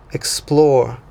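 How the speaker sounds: ordinary delivery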